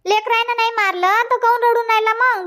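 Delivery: usual speaking voice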